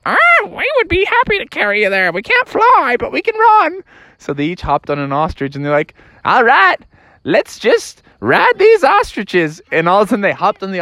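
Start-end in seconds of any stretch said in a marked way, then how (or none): 0.1-3.8 very high, quick bird voice; talking ostriches. Acted. Ostriches offer a ride, high fast bird voice. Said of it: "the ostriches said". 6.3-9.6 high, drawn-out drawl; game ostrich riders. Acted. Riders mount the ostriches, high slow voice.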